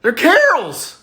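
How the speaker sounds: a high hammed-up kid owning up; sheepish kid voice. Punchline in kid voice.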